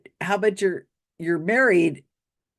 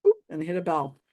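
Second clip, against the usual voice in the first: ordinary talk, nothing marked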